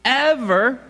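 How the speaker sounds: loud, high ever; call to the crowd. Real, not acted. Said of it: "y'all wanna say an ever with me".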